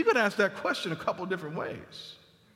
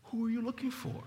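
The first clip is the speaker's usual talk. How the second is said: tender; 11 dB quieter than usual. Acted. Jesus asking the weeping Mary, tender. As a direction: ask softly, drawing the words out tenderly. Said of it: "the tenderer version of that".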